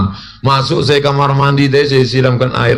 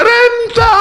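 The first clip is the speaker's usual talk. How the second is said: crying daughter. Retold or imitated. Daughter sobs the answer.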